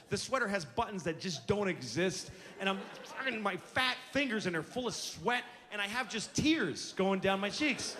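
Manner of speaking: ordinary talk, nothing marked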